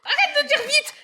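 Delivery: shrill laughing plea; high laughing protest. Real, not acted. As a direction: squeal it, laughing. Stressed laughing at partner's teasing.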